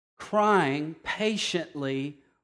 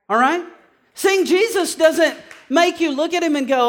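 ordinary delivery

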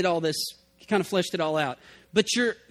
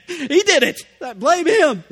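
mock Adam; 6 semitones higher, 7 dB louder than usual. Adam blaming serpent, mocking.

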